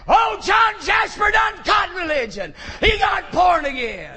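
high, loud jubilant shout; shouted from the crowd. Retold or imitated. Worker shouting the news to the whole warehouse, jubilant shout. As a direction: call it out over the crowd.

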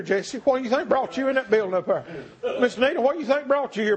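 normal talking voice

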